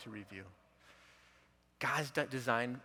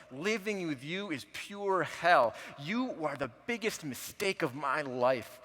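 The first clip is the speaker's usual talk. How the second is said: loud, slow, bitter retold accusation; imitating his wife's loud cutting words, slowly; harsh accusation; 4 semitones higher, 11 dB louder, slower than usual. Wife's cruel words, quoted by husband.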